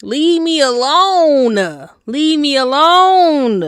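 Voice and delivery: whiny slow leave me alone; drawn-out plea. Retold or imitated. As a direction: stretch each word out, begging. Retold brushing off the barber.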